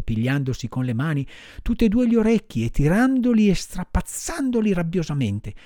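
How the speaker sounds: plain reading voice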